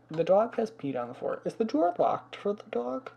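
ordinary delivery

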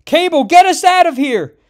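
high, very loud panicked shriek; terrified scream. A narrator's reading. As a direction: scream for rescue as the monster comes. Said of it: "as Nightcrawler screams".

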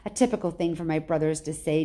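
ordinary delivery, nothing marked

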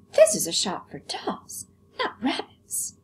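high prissy doll voice; haughty doll, very high; vain china doll. Acted. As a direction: look down your nose at the rabbit.

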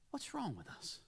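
soft, troubled question; hushed anguish. Retold or imitated. Augustine asking his friend what's wrong, hushed anguish. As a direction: ask it quietly, as if troubled.

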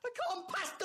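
laughing in despair; high, half-crying laugh at his own failure; exasperated laugh. Real, not acted.

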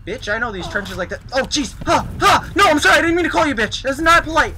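loud, high panicked yelling; frantic yelling. Real, not acted. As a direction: yell in panic while fleeing. Chased by dinosaur, panicking.

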